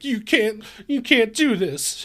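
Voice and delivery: a hint of a panicky nerd standing up; nerdy manager. Nerdy manager confronts the German.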